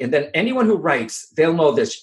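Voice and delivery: normal talking voice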